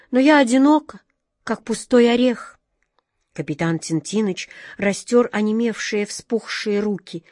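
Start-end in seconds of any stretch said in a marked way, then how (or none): 0.1-2.6 high, plaintive lament; plaintive sorrow; sad. A narrator's reading. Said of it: "печально, глядя куда-то вдаль, сказала белка".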